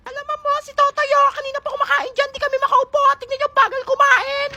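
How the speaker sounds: put-on high whiny kid telling on him; high child tattle voice; tattling child. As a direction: tell the teacher on him, high-pitched.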